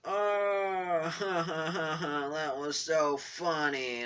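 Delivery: high, drawn-out hammed-up laughter running into the words; mock laughing. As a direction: imitate a friend cracking up, stretched and high. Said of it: "he was just like".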